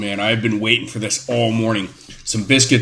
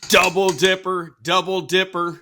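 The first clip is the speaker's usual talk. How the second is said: high sing-song teasing chant; teasing call-out; 8 semitones higher than usual. Real, not acted. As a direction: call him out, playful and high. Calling out double dipping.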